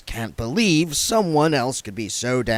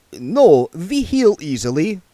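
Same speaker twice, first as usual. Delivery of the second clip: put-on vampire accent; 8 semitones higher, 6 dB louder than usual. A narrator's reading. Otto voiced.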